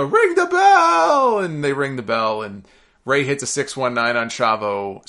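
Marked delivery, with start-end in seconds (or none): 0.0-1.4 very high, slow, stretched-out scream; wild yell. Retold or imitated. Dario Cueto ordering the bell rung, screaming wildly. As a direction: belt it across the arena. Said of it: "So he yells".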